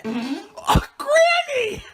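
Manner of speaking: high, loud shriek of surprise; startled cry of disgust; shocked shout. Real, not acted. Shocked at grandma's fart.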